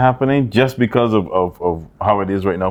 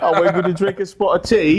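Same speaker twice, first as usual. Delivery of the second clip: a hammed-up English accent, pitched higher; mock posh British; 8 semitones higher than usual. Mocking the Anglo-Saxons as tea-sipping Brits, hammed-up British accent.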